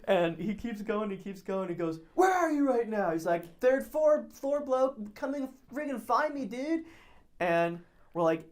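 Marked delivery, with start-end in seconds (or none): 2.1-3.1 high, loud demand; imitated angry call. Huge drunk stranger hunting his heckler, imitated loud call. 3.6-6.8 high-pitched, cocky calling out; imitated drunken taunt. Drunk friend daring the stranger upstairs, imitated taunt.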